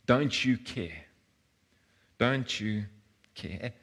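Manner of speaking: quiet, fading hurt question; softly accuse, trailing away; a touch of hurt. Retold or imitated. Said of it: "what do they level Jesus with".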